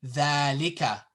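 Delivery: dhalika with a long first a; long vowel. Shown as a demonstration. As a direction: hold "dhaa" in dhalika. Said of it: "This is the way it sounds".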